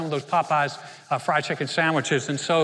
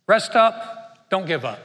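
emphatic rest up; 4 dB louder, slower than usual. Shown as a demonstration. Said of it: "I call them the three ups".

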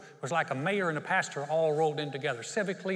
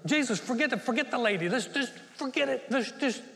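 high, loud, pleading to hurry up; exasperated father's outcry; impatient plea; 7 semitones higher, 4 dB louder than usual. Retold or imitated. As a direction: urge him on, near desperate.

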